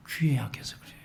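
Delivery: soft whisper; whispered punchline. Real, not acted. Preacher whispering the punchline to the congregation. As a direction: whisper it like a secret.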